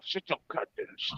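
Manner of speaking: through clenched teeth without moving lips; talking with mouth closed. Shown as a demonstration. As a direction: ventriloquist hiss of a strict parent. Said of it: "the ventriloquist talk".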